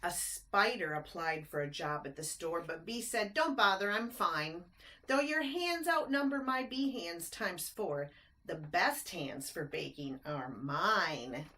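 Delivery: lilting rhymed storybook narration; bouncy read-aloud rhyme; reading to children. A narrator's reading. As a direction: read it like a bedtime picture book. Reads rhyming picture book aloud.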